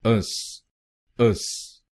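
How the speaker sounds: अस said as a short 'us', not 'ee-yas'. Shown as a demonstration. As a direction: model the ending अस as a quick 'us'. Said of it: "आईओयूएस हम बोलेंगे".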